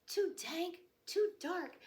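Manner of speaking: high little mouse voice; small mouse complaining in a high voice; squeaky mouse. Acted. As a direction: play the tiny scared mouse.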